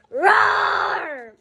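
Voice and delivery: loud high roar on request; tiger roar. Shown as a demonstration. Reader roars for the listening children, loud. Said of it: "Do you want to hear it again?".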